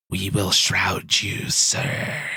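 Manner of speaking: slow, whispered pledge of a sneaky minion; sneaky kobold. Acted. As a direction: whisper it slowly, like a devoted robed kobold. Game master voices sneaky robed kobolds.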